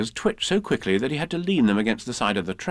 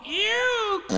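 high squeak. Acted. Piglet squeaking from under Pooh. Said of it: "squeaked Piglet".

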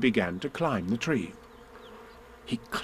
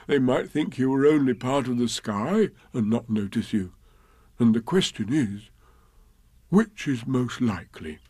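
lightly voiced bear, earnest. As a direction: bear carefully explaining a plan.

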